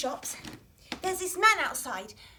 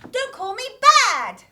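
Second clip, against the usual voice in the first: call and response line; 6 semitones higher, 9 dB louder than usual. Shown as a demonstration. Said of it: "I say something and then you copy me".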